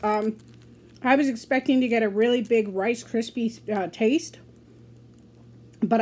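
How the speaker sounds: talking while chewing food; speaking between bites of snack; mouth full, chewing. Real, not acted. Seen 2: Bites bar at -4s, then chews and talks holding it.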